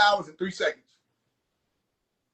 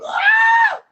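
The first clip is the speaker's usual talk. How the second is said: sharp high yelp of laughter; high laughing shriek; 8 dB louder than usual. Real, not acted. Cracking up at the joke, a shrill yelp.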